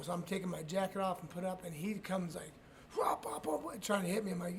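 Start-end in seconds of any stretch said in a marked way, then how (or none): 3.0-3.6 punch noises. Retold or imitated. Imitating punches being thrown.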